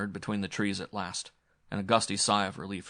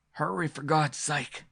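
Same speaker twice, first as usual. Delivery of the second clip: strangled; 7 semitones higher, slower than usual. A narrator's reading. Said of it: "Ashley's voice sounded strangled".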